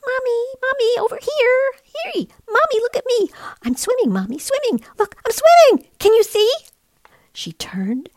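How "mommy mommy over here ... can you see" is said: very high, excited little chick. Acted. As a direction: squeal it like a thrilled tiny penguin. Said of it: "came a little voice she knew".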